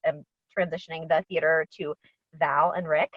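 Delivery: ordinary talk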